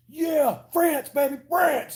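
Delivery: high-pitched mock cheer for France; mock patriotic cheer. Streamer imagining patriots in other countries, mock cheering. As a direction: whoop it up like a parody superfan.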